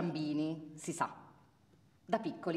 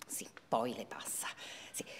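whispering; 6 semitones lower, 7 dB quieter than usual. Acted. Aside to listener about child.

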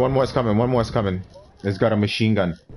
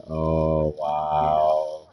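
usual speaking voice

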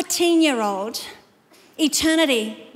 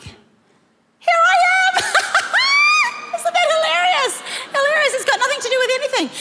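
delighted squeal. Real, not acted. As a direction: squeal through real laughter, slightly out of control. Showing her funny childhood photo, laughing.

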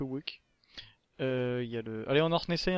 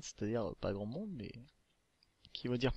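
usual speaking voice

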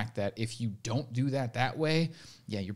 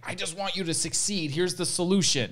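higher, earnest helper voice; eager fixer voice; 8 semitones higher than usual. Retold or imitated. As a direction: play the eager fixer handing over the answer.

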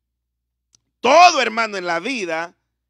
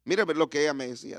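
usual speaking voice

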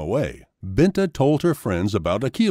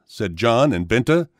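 ordinary delivery, nothing marked

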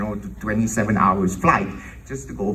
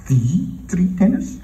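higher, drawn-out incredulous question; stunned disbelief; 6 semitones higher, slower than usual. Retold or imitated. Composer hearing who shares the concert, stunned. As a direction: echo it slowly, rising in astonishment. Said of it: "I'm going like, What?".

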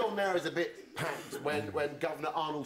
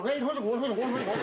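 high, loud mock challenge; scrappy little boxer; 9 semitones higher, 5 dB louder, faster than usual. Tiny wiry boxer squaring up, high loud mock challenge. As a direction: pipe up loudly as a tiny fighter. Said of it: "we get to go".

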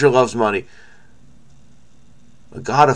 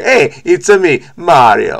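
hammed-up Italian plumber voice, louder; a jokey put-on Mario catchphrase; Mario impression; 5 semitones higher, 6 dB louder, slower than usual. To his viewers, joking about his bad accent, a hammed-up Mario voice. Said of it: "as I turn into Mario here".

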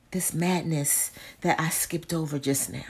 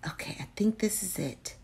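soft whispered aside; whispering; 9 dB quieter, faster than usual. Real, not acted. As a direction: whisper it to yourself. Repeats it in a whisper.

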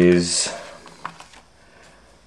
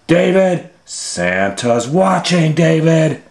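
calling warning; 7 semitones higher than usual. Acted. Warning child outside naked.

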